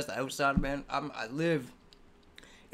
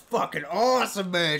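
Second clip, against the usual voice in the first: normal talking voice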